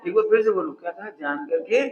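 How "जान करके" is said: low, soft "जान करके" lifting at करके; fill-in prompt. Shown as a demonstration. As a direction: invite the answer with a rise on "करके". Repeats phrase for listeners to finish. ("जान करके" पर सुर उठाकर जवाब माँगें)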